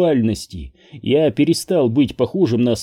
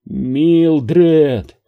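high, loud, drawn-out call; imploring cry, raised and stretched; pleading; 4 semitones higher, 5 dB louder than usual. A narrator's reading. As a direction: reach for someone with the name. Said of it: "Я продолжал тянуться".

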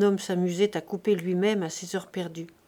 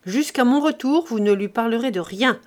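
stern order; 2 semitones higher, 5 dB louder than usual. Acted. Husband forbidding his wife to tell the nephew, stern order. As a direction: lay down the law to the household. Father gives orders to household.

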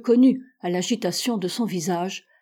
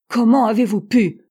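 loud, furious reproach; raised voice of an indignant accuser; angry growl; 3 semitones higher, 8 dB louder than usual. A narrator's reading. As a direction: thunder the question in outrage. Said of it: "gronda-t-il".

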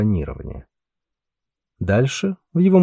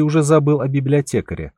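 plain reading voice

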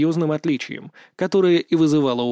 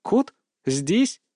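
taken-aback wonder; astonished questions; 5 semitones higher than usual. A narrator's reading. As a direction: question it in disbelief. Teacher astonished to see a cat.

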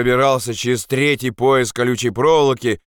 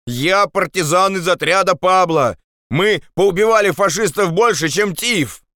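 high, indignant shout; 9 semitones higher than usual. Acted. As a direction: protest loyalty at a raised pitch. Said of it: "крикнул Андрес". (возмущённый крик)

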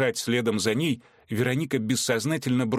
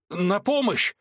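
breathless cry for help; 9 semitones higher, slower than usual. A narrator's reading. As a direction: gasp out a call for help. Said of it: "задыхаясь, крикнул он".

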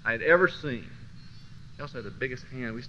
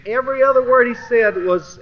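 every other word, raised loud and high; an emphatic raised opener; 7 semitones higher, 10 dB louder than usual. Real, not acted. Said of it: "repeats the phrase, first take loud".

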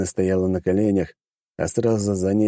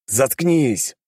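firm, higher, commanding shut-up; forceful command; 6 semitones higher than usual. Acted. Hero forces the demon silent with his voice. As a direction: put power into the order. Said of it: "добавил я силы в свой голос".

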